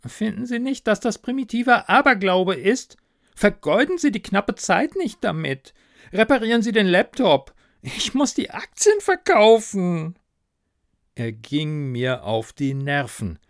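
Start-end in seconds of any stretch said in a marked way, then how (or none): 0.0-10.2 high, agitated, whining ghost; nagging ghost manager. Acted. Ghost urges narrator to repair laptop, high nagging whine. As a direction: scold in a high voice, rising to a wail.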